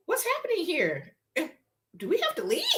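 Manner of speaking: high, startled disbelief; lost at what's unfolding; bewildered. Retold or imitated. Bewildered at the scene.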